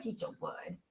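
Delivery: whispered praise, nearly voiceless; soft fond whisper. Real, not acted. Praising the dog to viewers, soft whisper. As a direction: breathe it softly, fond of the dog.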